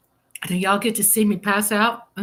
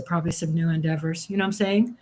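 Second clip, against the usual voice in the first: usual speaking voice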